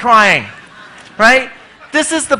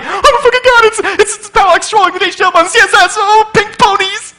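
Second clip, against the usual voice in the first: high, loud, fast squealing fanboy; excited squeal; 12 semitones higher, 7 dB louder than usual. Retold or imitated. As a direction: gush like a thrilled fan, pitched way up. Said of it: "he'd be like".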